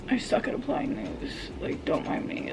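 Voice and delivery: unvoiced mutter under breath. Real, not acted. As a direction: mumble it to yourself. Muttering while applying mask.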